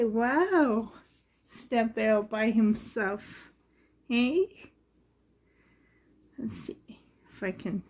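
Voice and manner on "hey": normal talking voice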